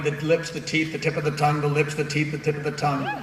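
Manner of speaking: "the tip of the tongue" crisply articulated; "the tip of the tongue" chanted evenly, every consonant placed; diction drill. Shown as a demonstration. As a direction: recite "the lips, the teeth" as a rapid articulation exercise. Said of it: "tongue twisters and speech classes".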